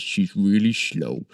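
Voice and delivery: a hint of the dopey orc Baron, slow and drawn out; light orc impression at a slow crawl; lightly voiced slow orc. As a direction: ease into a slow, hunched orc voice. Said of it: "and he talks like this".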